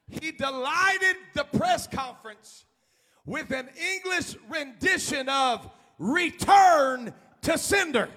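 slow, shouted sermon delivery; preacher lifting the story up; full-force preaching. Acted. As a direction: stretch each phrase to the congregation. Preacher retelling miner story.

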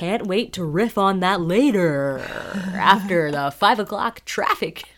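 slow, cheesy radio DJ; radio host voice. Pretend. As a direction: ham it up like morning radio. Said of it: "imagine if I had to talk that way".

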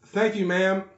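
loud, high mock thanks; retold loud blunder. DeMarcus retold thanking the drive-thru worker, in a loud mock voice. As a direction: boom out an imitated awkward thank-you.

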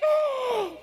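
high, shouted excitement; thrilled Peter. Acted. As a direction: yell it in disbelief and joy. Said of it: "He's like".

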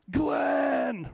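high sustained mock-divine call; big booming voice. Preacher mimicking God yelling down at someone, booming shout. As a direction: holler it out long and high. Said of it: "this big booming voice".

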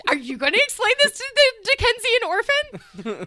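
high, loud disbelieving question; incredulous. Real, not acted. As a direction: squeal it in comic disbelief. Incredulous challenge amid laughter.

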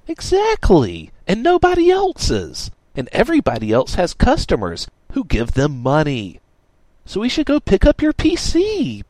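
high, loud, emphatic push; emphatic declaration. A narrator's reading. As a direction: drive the point home, loud and high. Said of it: "Radix declared".

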